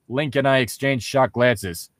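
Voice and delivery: normal talking voice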